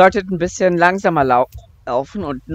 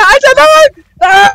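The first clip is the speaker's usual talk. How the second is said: high panicked screaming; terrified scream; 15 dB louder, slower than usual. Real, not acted. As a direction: scream in real fright. Monster appears, screams. (panischer Schrei)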